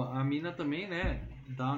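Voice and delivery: ordinary talk, nothing marked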